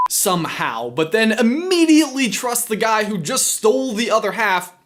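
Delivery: energetic, punchy host delivery; presenter read. Acted. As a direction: sell the script to camera. Reads scripted line to camera.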